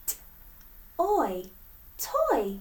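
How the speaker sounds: "T-, oi, toy" split into sounds, then blended; sounding out. Shown as a demonstration. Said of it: "Let's sound out the word toy".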